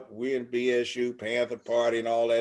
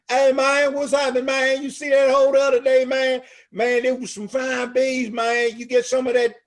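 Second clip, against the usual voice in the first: high, loud hey-man street voice; loud street guy; 10 semitones higher, 5 dB louder than usual. Retold or imitated. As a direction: holler it like a loudmouth in the parking lot. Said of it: "and somebody".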